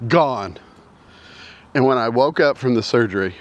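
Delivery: ordinary talk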